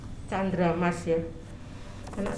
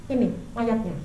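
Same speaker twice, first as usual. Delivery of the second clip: ordinary talk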